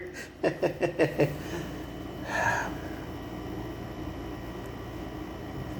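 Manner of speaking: unvoiced chuckle; airy laugh trailing off; soft breathy laugh. Real, not acted. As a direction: let out a quiet breathy laugh. Laughs at his own story.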